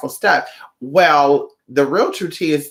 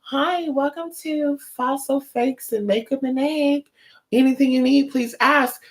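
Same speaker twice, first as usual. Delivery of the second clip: bored shop clerk; 9 semitones higher than usual. Retold or imitated. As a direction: parody a dull shop worker's welcome. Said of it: "they're so boring".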